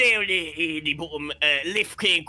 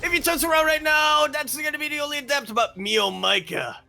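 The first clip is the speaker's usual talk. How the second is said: high-pitched, rapid live commentary; excited play-by-play; 12 semitones higher than usual. Real, not acted. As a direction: burst out high as the attack looms. Caster excited by live attack.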